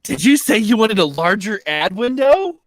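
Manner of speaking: high, loud eager squeal; giddy over ad space; thrilled exec. Pretend. Mock YouTube excitement.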